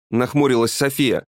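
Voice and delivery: usual speaking voice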